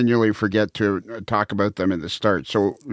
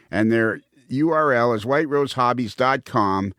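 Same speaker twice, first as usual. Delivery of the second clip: ordinary talk, nothing marked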